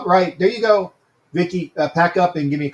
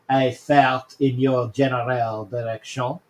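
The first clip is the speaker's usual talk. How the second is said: slow Monty Python French taunt; a lightly hammed French accent; mock French accent; 2 semitones lower, slower than usual. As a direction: fake French insult, slowly. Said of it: "You know Monty Python, you know what I just did".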